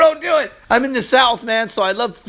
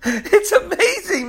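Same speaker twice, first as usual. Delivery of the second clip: laughing with joy; 10 semitones higher, slower than usual. Real, not acted. Laughing in joy over scripture.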